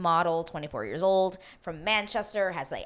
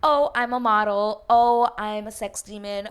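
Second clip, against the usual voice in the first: higher, louder airhead intro; mocking contestant voice; 3 semitones higher, 7 dB louder than usual. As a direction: parody a vain contestant. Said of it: "other than".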